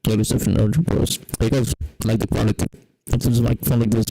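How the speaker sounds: muffled, booming too-close microphone sound; mouth on the mic. Real, not acted. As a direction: press your mouth to the mic and talk. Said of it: "I can put my mouth on this thing".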